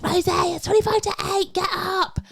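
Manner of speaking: mock urgent call. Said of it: "So I was like".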